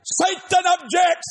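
a trader nicknamed Satan shouting an objection; mock objection. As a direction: call it out across the pit. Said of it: "All of a sudden I hear".

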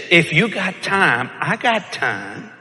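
slow, teasing come-on; slow flirty purr. Retold or imitated. Woman on plane flirting, slow and teasing. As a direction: drawl it out seductively.

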